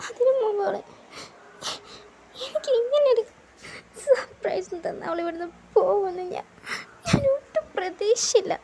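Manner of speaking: sobbing. Acted. [name] sobbing to [name] about her leaving. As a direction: wail that he never expected it. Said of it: "നീ എന്തിനാ കരയുന്നേ?".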